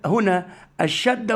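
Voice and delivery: ordinary delivery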